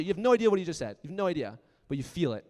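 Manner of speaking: ordinary delivery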